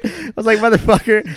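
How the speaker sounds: high, loud mock curse; loud exasperated curse. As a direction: blurt it out in exasperation. Loud mock outburst quoted.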